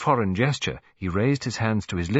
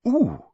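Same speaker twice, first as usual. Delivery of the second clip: put-on very high, louder child's ooh; delighted ooh; 6 dB louder than usual. A narrator's reading. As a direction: squeal at the find. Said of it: "said Colin, diverted".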